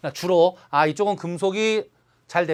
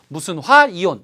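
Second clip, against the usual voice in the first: 무슨 화, 이온 with 화 loud and held; stress on 화; 5 dB louder than usual. Shown as a demonstration. Teacher giving the anion naming pattern, stress on 화. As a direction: punch 화 after 무슨, then ease onto 이온. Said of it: "이렇게 간다".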